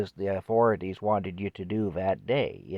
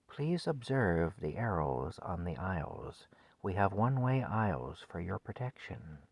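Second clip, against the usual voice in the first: soothing announcer; 9 dB quieter than usual. Retold or imitated. Man imitating the store's PA recording of a woman, soft and soothing. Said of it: "it was this soothing woman's voice".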